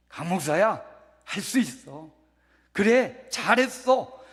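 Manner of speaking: loud, emphatic pep talk to oneself; positive self-encouragement. Acted. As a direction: cheer yourself on with full voice. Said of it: "나 스스로 내면의 긍정적인 소리".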